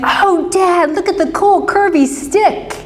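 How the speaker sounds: put-on a child calling to dad about a cool stick; excited little kid. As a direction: play the six-year-old thrilled by a stick. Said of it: "And you went".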